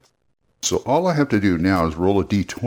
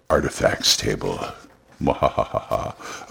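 low villain voice with evil cackle; mad scientist; 9 semitones lower, 3 dB quieter than usual. Pretend. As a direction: gloat over the table, then cackle. Dreaded artifacts table, evil laugh.

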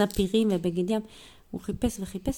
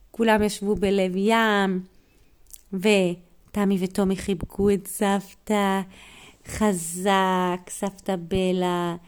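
ordinary delivery

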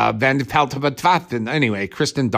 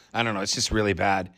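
ordinary talk, nothing marked

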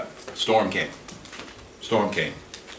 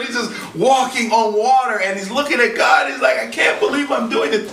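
excited; 6 semitones higher than usual. Acted. Peter amazed walking on water.

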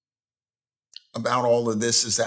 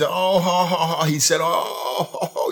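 higher, laughing "you're killing me" imitation; imitated hearty laughter; 4 semitones higher than usual. Preacher imitating a friend's habit of speech, mock laughing. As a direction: mimic a friend cracking up helplessly. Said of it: "This is the way he spoke".

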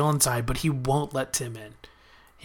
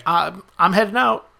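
a hint of a higher young woman's voice; lightly put-on daughter voice, announcing she's leaving; casually imitating Willow, pitched up; Willow heading out; 5 semitones higher, 4 dB louder than usual. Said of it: "So Willow's like".

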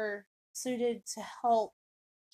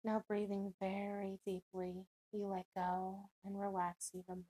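guided meditation; 7 dB quieter than usual. Acted. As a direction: ease the listener down into calm, unhurried.

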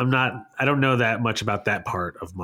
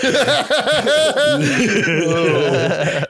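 loud laugh; 11 semitones higher, 5 dB louder than usual. Real, not acted. Laughing at being high.

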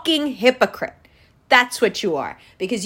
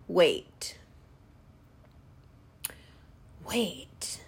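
hushed realization; 9 semitones lower, 14 dB quieter than usual. Real, not acted. A dawning realization.

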